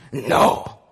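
lightly voiced low villain refusal; a hint of a stern, deep husband; stern Bluebeard. A narrator's reading. Bluebeard refuses his wife's plea, sternly.

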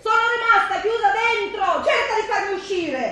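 high, desperate pleading; panicked. Acted. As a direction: beg to be let out. Trapped behind a locked door.

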